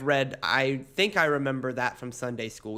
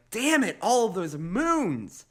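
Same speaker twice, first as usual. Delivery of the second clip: mock frustration; 6 semitones higher, slower than usual. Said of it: "everybody's like".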